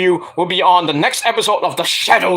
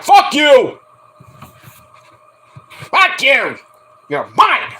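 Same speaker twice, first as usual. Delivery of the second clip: mock possessive yell; 7 semitones higher, 4 dB louder, much slower than usual. Voicing Rosa possessive.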